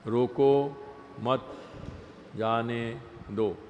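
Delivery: slow, drawn-out reading with pauses; word by word. Shown as a demonstration. Teacher quoting an example line from scripture, word by word. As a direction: spell it out one word at a time. Quotes the words neutrally, word by word.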